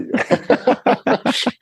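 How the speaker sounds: high, breathy burst of laughter; long self-mocking giggle; real laugh. Real, not acted. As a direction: laugh at your own English. Jokes about their own English.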